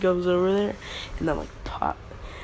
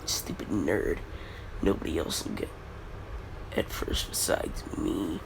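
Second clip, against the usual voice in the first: low, softer grumbling snarl; grumbly Bakugo; 12 semitones lower, 8 dB quieter than usual. Retold or imitated. Bakugo sneering at a rival, low grumbly snarl. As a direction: grumble like a small angry dog. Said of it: "Angry Pomeranian noises".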